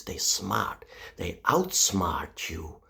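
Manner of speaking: sly hushed whisper. Acted. Whispered to Americans, a sly whisper. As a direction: breathe it like a secret. Sinister Russian whisper bit.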